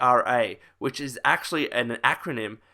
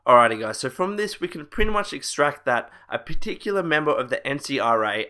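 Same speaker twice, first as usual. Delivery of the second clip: ordinary talk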